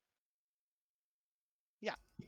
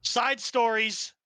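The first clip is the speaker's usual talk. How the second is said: drawn-out, louder announcement; called out; 5 semitones higher, 7 dB louder, much slower than usual. Acted. Game master labelling the players' detour, slow loud announcement. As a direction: call it out slowly and firmly. Same words called out, then dropped.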